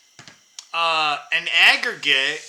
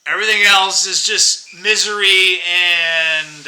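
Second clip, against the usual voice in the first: normal talking voice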